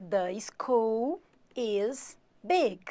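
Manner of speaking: "The school is big" said very slowly, word by word; slow model sentence. Shown as a demonstration. Teacher models an English sentence for the class, very slowly. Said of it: "Eu posso falar".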